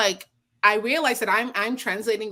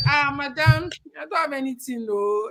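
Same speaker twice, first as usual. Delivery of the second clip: put-on higher-pitched Nigerian-accented refusal; refusing in Nigerian accent; 6 semitones higher than usual. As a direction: plead empty pockets in a Nigerian accent. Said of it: "I look at them and I'm like".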